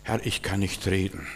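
very soft, low, timid Moses; hushed, feeble imitated plea; meek objection. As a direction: object to God in a small voice. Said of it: "Moses hat hier Gott widersprochen".

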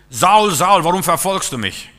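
loud, high booming call; divine call. Retold or imitated. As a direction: call out like God from heaven. God's voice calling Saul. (laut und hoch rufend wie Gottes Stimme)

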